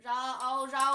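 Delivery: loud model "รอเอาเรา"; spelling-out recitation. Shown as a demonstration. Teacher adding the vowel for learners, loud recitation of "รอเอาเรา". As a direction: sound out "รอเอาเรา" part by part, loudly. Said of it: "เจ้าสระเอาลงไป".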